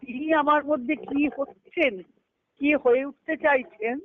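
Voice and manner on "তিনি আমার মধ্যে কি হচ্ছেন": ordinary delivery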